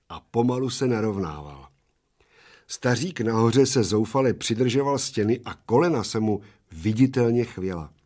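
plain reading voice

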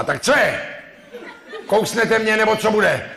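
high, loud, laughing demand; hammed-up lady losing patience; impatient woman. Woman presses the man to get on with it, high and loud.

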